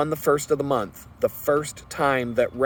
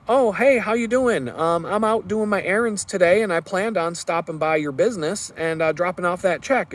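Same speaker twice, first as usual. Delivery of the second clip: higher, overly cheerful phone voice; breezy cheerful; 6 semitones higher than usual. Retold or imitated. Calling the landlord back, putting on a breezy cheer. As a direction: sound carefree and friendly.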